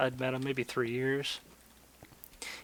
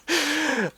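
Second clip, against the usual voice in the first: real laugh; 12 semitones higher than usual. Real, not acted. Laughing at his own story.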